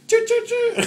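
high squealed choo choo; shrill train whistle impression.

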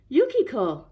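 loud, high exclamation; shocked cry. A narrator's reading. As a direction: cry out her name in shock. Husband discovers wife is crane.

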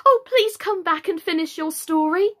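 high, loud entreaty; calling after. A narrator's reading. Alice calling the departing mouse back, pleading. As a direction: call out pleadingly to someone leaving. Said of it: "Alice called after it".

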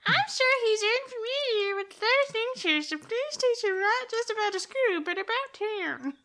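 high, put-on teacher voice; new character voice. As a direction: lift the voice into a new persona. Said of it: "I wanna try the new voice".

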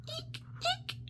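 tiny high eek; squeaky shoe. Shown as a demonstration. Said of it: "like a squeaky shoe".

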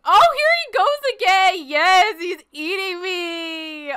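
high wail, stretched out at the end; hammed-up alarm. Player being eaten by another player, high mock-alarmed wail. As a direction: cry out in mock horror, high-pitched.

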